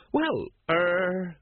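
drawn-out, uncertain stalling; hesitant. A narrator's reading. Sergeant unsure how to answer his captain, hesitant. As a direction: stall for time on a long er.

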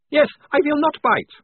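high, loud, cheery vampire; vampire reassuring the soldier, high and cheerful. A narrator's reading. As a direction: chirp it up high like a friendly vampire. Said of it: "said the face cheerfully".

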